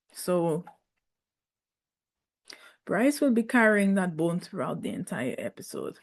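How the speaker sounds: ordinary talk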